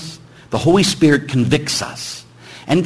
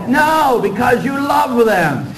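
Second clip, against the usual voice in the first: emphatic shout; 9 semitones higher, slower than usual. Real, not acted. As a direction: shout the answer to the students.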